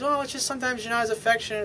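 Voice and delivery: a hint of a complaining girlfriend; girlfriend's voice. Girlfriend voiced in retold scene.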